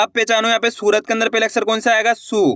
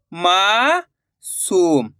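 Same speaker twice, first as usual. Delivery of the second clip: "मासूम" very slow, long rising मा, pause, falling सूम; drawn-out vowel; 3 semitones lower, much slower than usual. Shown as a demonstration. Teacher showing the long vowel sign, "मासूम" drawn out in two parts. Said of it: "खींच के निकल रहा".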